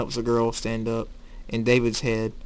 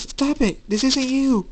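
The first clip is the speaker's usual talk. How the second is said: high-pitched, raised cry; desperate plea; 4 dB louder than usual. Acted. Girl runs to Jacob to stop him, high desperate cry. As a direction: plead urgently, voice pitched way up.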